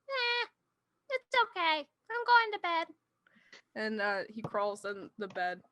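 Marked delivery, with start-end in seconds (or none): 0.1-2.9 higher character voice. Acted. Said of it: "And he says".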